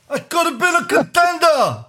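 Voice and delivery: Brando impression. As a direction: belt it like Brando in the movie. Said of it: "It was like".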